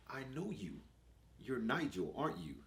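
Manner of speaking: put-on soft, quiet posh boy voice; a small well-spoken boy, quiet and polite; little posh voice. To his older brother, a quiet posh little boy. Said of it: "He's got this little posh voice".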